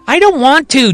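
put-on high, whiny kid refusal; childish refusal. A narrator's reading. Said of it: "Johnny Shelby responds".

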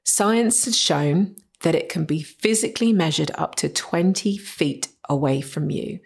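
usual speaking voice

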